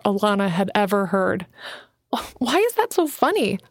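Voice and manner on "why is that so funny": demanding. Acted. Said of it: "Alana demanded".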